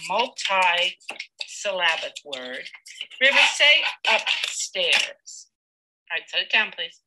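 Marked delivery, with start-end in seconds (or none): 3.2-5.5 slow, deliberate prompt to the student. Real, not acted. Teacher asks the boy slowly, drawn out.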